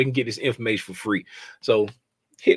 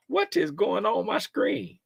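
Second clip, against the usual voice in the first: ordinary talk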